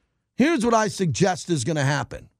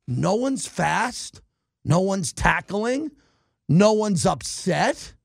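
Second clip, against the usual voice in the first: slow disgust; much slower than usual. Real, not acted. Ranting about the team.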